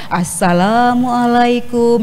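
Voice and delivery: slow, stretched and sing-song; drawn-out lilting greeting. Shown as a demonstration. Lecturer shows students an overlong greeting, slow and stretched. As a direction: drag every syllable out like a long-winded speaker. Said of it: "Misalnya panjang gitu, mendayu-dayu".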